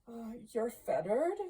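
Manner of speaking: a touch of timid questioning; trembling. A narrator's reading. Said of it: "said Scrooge, trembling".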